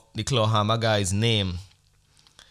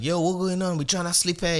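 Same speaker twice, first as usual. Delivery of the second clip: high, raised call out; mock complaint; 8 semitones higher than usual. Calling to the men outside, a raised complaint. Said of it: "I would have been like".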